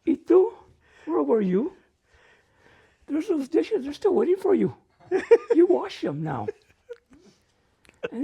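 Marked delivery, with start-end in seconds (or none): 0.1-6.5 lightly acted, raised-pitch motherly telling-off; a touch of a stern mother giving orders; scolding mom. Mom turning on the dish-dodging daughter, mock scolding. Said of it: "then she looks to Elma".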